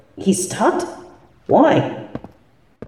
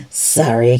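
ordinary talk, nothing marked

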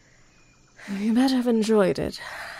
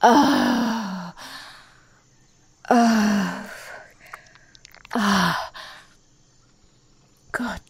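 fading moans; 3 semitones higher, 4 dB louder, much slower than usual. Acted. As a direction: come down slowly, out of breath.

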